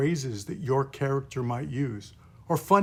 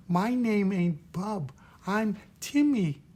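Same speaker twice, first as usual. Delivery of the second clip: put-on higher, slow little kid; little child voice; 6 semitones higher than usual. Shown as a demonstration. Timmy the little kid correcting his name, high slow child voice. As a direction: answer back like a small child. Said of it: "the five core sounds".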